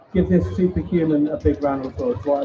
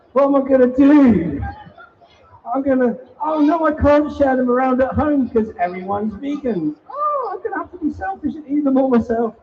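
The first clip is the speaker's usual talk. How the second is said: higher, hammed-up oh-no panic; mock dismay; 7 semitones higher than usual. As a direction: play up fake distress for the kids. Mock panic over cracked box.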